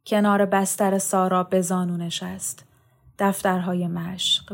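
ordinary delivery, nothing marked

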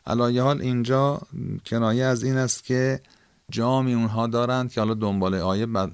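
ordinary talk

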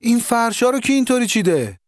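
angry shout. A narrator's reading. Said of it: "صدای داد علی آقا بلند شد".

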